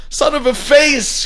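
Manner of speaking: high, loud, drawn-out cry of pain; high anguished shout. A narrator's reading. Father hits his thumbnail with a hammer, high anguished shout. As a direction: shout it high and anguished, stretching the last word. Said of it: "he would shout in a high, anguished voice".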